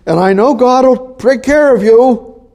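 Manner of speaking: high, loud reassuring king; hammed-up king's reassurance. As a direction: call down to Daniel as the worried king. King Darius voiced in retelling.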